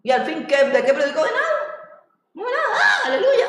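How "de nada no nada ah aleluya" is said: mock preacher screaming. Preacher covering an empty sermon, mock high-pitched screaming. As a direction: imitate a shrieking preacher up high. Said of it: "un poco grito".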